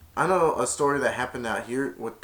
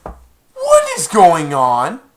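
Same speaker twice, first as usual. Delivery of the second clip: slow, loud, higher cry of disbelief; shocked outburst; 6 semitones higher, 14 dB louder, much slower than usual. Real, not acted. Reacting to the story, a loud stunned cry.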